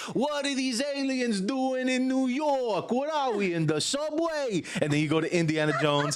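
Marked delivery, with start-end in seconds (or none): 0.1-4.6 much higher, slow cartoon mobster; mock New York mobster. Retelling the Great Movie Ride, she voices the mobster, hammed-up and high. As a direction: ham up the wisecracking gangster. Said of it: "he's like".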